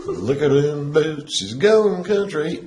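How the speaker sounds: high, slow country drawl; hammed-up country holler; country accent. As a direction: stretch it out high in a mock country twang. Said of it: "you trying to be country".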